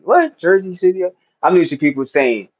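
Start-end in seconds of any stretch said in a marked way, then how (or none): 0.0-1.0 sharp jump up on what, then a puzzled Jersey City; mock double take, pitched high; surprised what. Retelling his confusion at the chat message, a mock surprised double take. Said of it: "I'm thinking, like".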